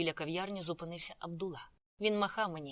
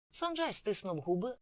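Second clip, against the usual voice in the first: usual speaking voice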